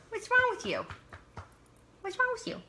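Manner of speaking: squeaky high teasing; high pet-talk teasing. Real, not acted. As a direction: tease the dog in a very high voice. Teasing the dog.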